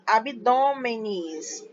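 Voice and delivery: "abdômenes" drawn out slowly with an extra final syllable, -me-nes; ending in -es. Shown as a demonstration. Teacher showing the alternative plural with E S, "abdômenes" slowly with -nes. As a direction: stretch "abdômenes" so the -es ending is heard. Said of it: "Ou pode ser o E S".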